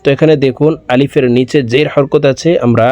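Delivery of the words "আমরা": normal talking voice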